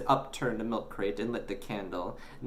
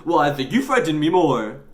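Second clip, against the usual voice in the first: bickering; 8 semitones higher, 9 dB louder than usual. Acted. Boy and newsagent bickering.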